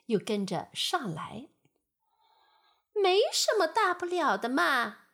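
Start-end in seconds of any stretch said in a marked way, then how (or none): 2.9-5.1 high, loud casual fly voice; carefree flies. Acted. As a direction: shrug off the danger. Flies returning. (满不在乎的苍蝇)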